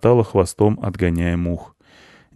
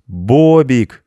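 put-on drawn-out, louder childlike call; children in chorus; 5 semitones higher, 6 dB louder than usual. A narrator's reading. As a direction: sing out the answer like kids together. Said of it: "сказали дети хором".

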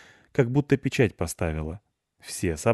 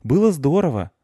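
put-on boy's voice; 7 semitones higher, 6 dB louder than usual. Boy thanks dog at gate.

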